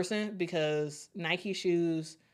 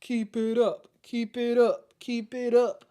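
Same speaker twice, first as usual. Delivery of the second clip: higher, evenly repeated chant; cheering sticker character; 5 semitones higher, slower than usual. Acted. Sticker character urging the streamer on, cheering chant. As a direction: voice a little weightlifting mascot cheering. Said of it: "pair character lifting some weights saying".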